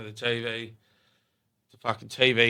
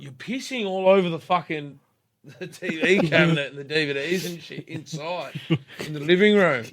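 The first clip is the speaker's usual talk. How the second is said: raised, higher voice of disbelief; incredulous scolding; 6 semitones higher, 4 dB louder than usual. Retold or imitated. Telling a mate off for pissing indoors, incredulous scolding. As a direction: tell him off, appalled and amused.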